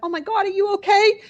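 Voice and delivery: mock a stranger's shocked check-in; high loud imitated alarm; alarmed concern. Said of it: "I would have went".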